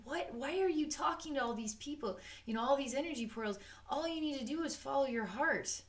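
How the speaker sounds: the heart gently scolds the chatter. Pretend. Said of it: "And the heart is just saying".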